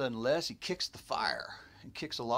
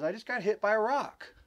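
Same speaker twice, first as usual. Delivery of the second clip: higher-pitched mock grievance; retold indignant complaint; 8 semitones higher, 4 dB louder than usual. Les complaining to laughing friends, retold indignation. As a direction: imitate a friend protesting to the group.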